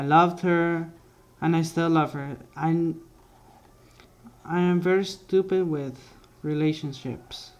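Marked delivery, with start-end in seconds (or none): none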